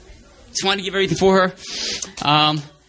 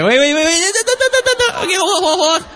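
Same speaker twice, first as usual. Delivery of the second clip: excited stop; 13 semitones higher than usual. Real, not acted. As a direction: cut yourself off with a rush of excitement. Halts story, excited self-interruption.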